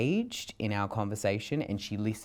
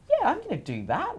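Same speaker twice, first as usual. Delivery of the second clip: eager self-quote. Retold or imitated. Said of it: "I was like".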